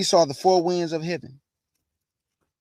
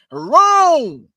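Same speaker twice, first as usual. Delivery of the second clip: emphatic answer; 7 semitones higher, 9 dB louder than usual. Real, not acted. Answering his own question.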